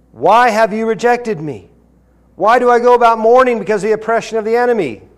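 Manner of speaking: impassioned psalm read aloud; scripture reading. Acted. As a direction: read the psalm as a cry to God.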